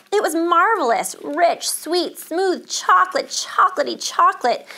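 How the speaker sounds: high, emphatic storytelling delight; thrilled, punchy listing; rapturous relish. A narrator's reading. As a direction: savour each word with mounting pleasure. Narrator relishes the marvelous taste.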